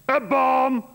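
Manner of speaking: high, loud alarmed shout. Retold or imitated. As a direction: yell a warning to scatter them. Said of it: "McTavish shouts".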